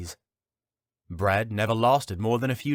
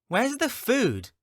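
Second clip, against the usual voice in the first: put-on high, slow whining kid; whiny child voice; 4 dB louder, slower than usual. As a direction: drag it out like a hungry brat. Hungry youngling demands food.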